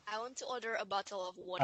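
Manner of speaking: quiet, unconvincing British accent attempt; failed British accent. Shown as a demonstration. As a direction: attempt British but stay American. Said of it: "no, you can't".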